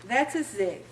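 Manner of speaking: ordinary talk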